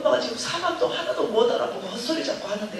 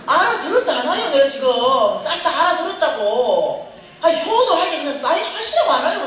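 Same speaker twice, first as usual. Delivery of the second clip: louder and higher, half laughing; laughing exasperated yell; 5 semitones higher, 8 dB louder than usual. Retold or imitated. Daughter to mother, higher laughing yell. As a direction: shout it, fed up but amused.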